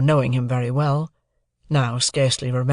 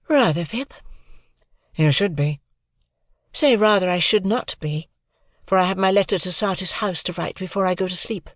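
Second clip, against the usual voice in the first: put-on higher, cool young lady's voice; a proud young woman answering lightly; Estella voice; 6 semitones higher than usual. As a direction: play Estella, poised and aloof.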